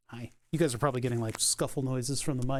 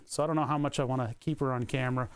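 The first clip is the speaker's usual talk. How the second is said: ordinary talk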